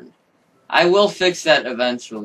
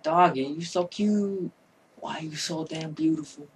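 cooing; 4 semitones higher, 6 dB quieter than usual. Real, not acted. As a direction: coo at the doggy. Cooing at the dog.

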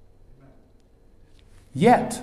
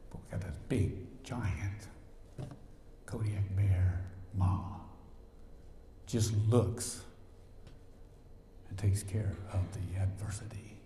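soft, much softer whisper; hushed whisper; 5 semitones lower, 10 dB quieter than usual. Acted. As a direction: hush it like a secret of comfort. Awed aside about God's protection.

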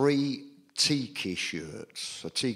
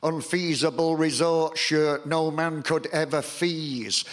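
raised, rhythmic poem delivery a bit higher and louder; poem recital; 5 semitones higher, 3 dB louder than usual. Acted. Poet performing to a live audience, declaiming rhythmically. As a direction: declaim it to the crowd with punch.

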